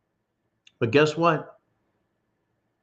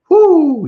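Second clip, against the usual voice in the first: loud high whoop; whoo shout; 8 dB louder than usual. Real, not acted. Host whooping to his audience, loud and high.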